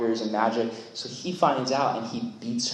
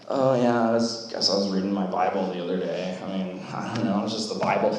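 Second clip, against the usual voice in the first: sheepish mumble; 7 semitones lower, 3 dB quieter, faster than usual. Pretend.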